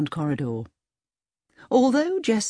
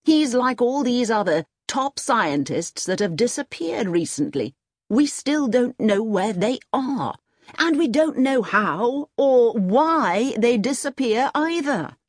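raised pitch, emphatic and slowing; lightly voiced insistent colonel; 5 semitones higher than usual. Colonel telling Jessup about vanished scientists, emphatic. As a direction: press each point as a worried colonel, lightly acted.

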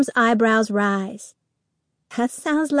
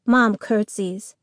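usual speaking voice

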